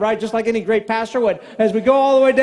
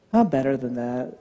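low, soft self-correction; retold chastened murmur; calmed down; 9 semitones lower, 7 dB quieter than usual. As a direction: settle down after a deep breath. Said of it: "take a deep breath".

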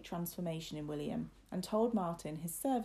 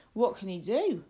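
loud, knowing retort; emphatic Martin; 2 semitones higher, 8 dB louder than usual. Acted. Lad answers young master's protest, loud and emphatic. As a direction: throw it back louder, as a knowing lad.

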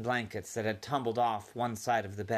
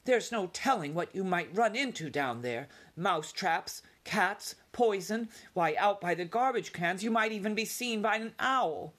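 high, anxious list of dangers; lightly voiced fretting mother; worried mother mouse; 8 semitones higher than usual. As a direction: frighten him off with warnings.

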